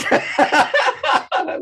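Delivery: real amused burst of laughter; high loud laugh. Real, not acted. Preacher laughing at the question put to the blind man, loud laugh. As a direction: crack up at the dumb question.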